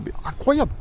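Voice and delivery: high, amazed exclamation. Real, not acted. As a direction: marvel at it, voice jumping up. Marvelling at the museum planes.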